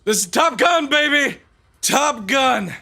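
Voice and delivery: whooping Top Gun cheer, high and loud; cocky pilot on radio. Pretend. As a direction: yell it like a hotshot fighter pilot. Celebrating a kill, Top Gun.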